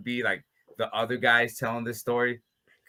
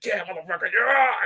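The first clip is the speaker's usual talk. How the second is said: triumphant yell; 6 semitones higher than usual. Retold or imitated.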